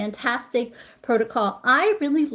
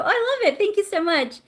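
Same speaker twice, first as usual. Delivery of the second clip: delighted; 9 semitones higher, faster than usual. Real, not acted. Reacting to viewers' hellos.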